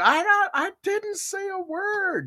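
high, slow put-on innocent voice; insisting she said nothing to grandma; mock innocence. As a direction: play dumb sweetly.

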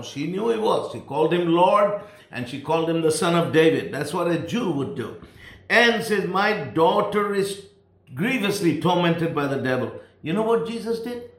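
normal talking voice

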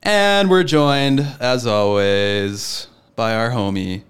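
loud, very slow drawn-out intro; announcer introduction. Acted. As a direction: ceremonial co-host introduction. Host introduces co-host with fanfare.

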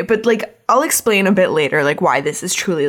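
usual speaking voice

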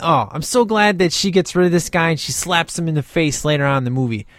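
ordinary delivery

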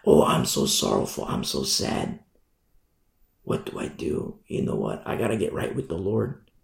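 very low, hushed, broken penitence; acted godly sorrow. Preacher acts out a sinner turning to the Lord, hushed sorrow. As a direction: sink into a soft, near-whispered confession. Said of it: "Godly sorrow leads to repentance".

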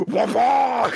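high, loud mock whack; imitated impact noise. Podcaster voicing the shitty club's hit, exaggerated. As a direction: blurt a loud cartoon hit. Imitating the club hitting the ball.

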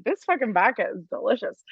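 ordinary talk

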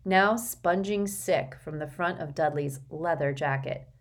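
ordinary delivery

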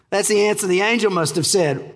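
normal talking voice

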